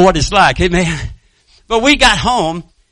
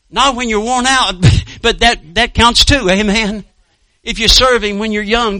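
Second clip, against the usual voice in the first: raised, emphatic preacher voice; fervent preaching; 3 semitones higher, 4 dB louder than usual. Real, not acted. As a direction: exhort the congregation with conviction. Preaches exhortation to congregation.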